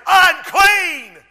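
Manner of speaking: high, loud drawn-out cry; shouted warning. Acted. Lepers warn anyone who comes near, shouted warning. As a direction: call it out to warn people away. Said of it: "they were required by federal law to say".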